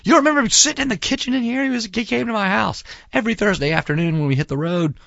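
put-on high, fast, insistent plea to his kids; excited insistence. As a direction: make them remember him, almost shouting. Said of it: "I'm like".

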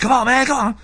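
high-pitched, urgent nagging voice; put-on high voice, pestering; mock wake-up call. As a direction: imitate a pal rousing a sleeper. Acts Elton rousing hungover friend.

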